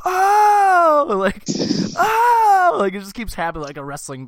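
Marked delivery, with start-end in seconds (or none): 0.0-1.1 high, loud drawn-out "ohh"; crowd's loud "oh". Retold or imitated. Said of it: "these people just go". 2.0-2.8 high, loud drawn-out "ohh"; crowd's loud "oh". Retold or imitated. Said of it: "these people just go".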